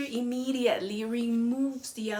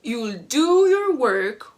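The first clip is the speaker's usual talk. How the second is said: slow, loud stern warning; stern teacher threat; 8 dB louder, slower than usual. Retold or imitated. Teacher threatening the defiant student, slow and loud. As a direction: lay down the law, slowly.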